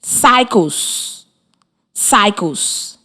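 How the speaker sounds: Cycles with the ending s made clear; careful classroom model of Cycles; stressing the final s. Shown as a demonstration. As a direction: Cycles said softly, marking the s.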